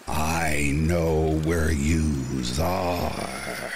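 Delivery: slow, drawn-out eerie call; haunting voice. Retold or imitated. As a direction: stretch each word like a ghostly taunt. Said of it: "an eerie voice howled from the darkness".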